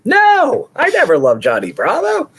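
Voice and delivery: high burst on the first word, then easing; mock breakdown. Rosemary breaking down under questioning, hammed-up outburst. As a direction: imitate a witness cracking on the stand. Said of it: "she breaks down. She's like".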